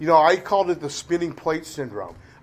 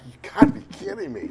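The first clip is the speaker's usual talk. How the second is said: much higher, fast incredulous exclamation; disbelief; 13 semitones higher, faster than usual. Retold or imitated. Ex-girlfriend hearing his amends, high fast disbelief. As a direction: squeak out her stunned disbelief. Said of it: "And she's like".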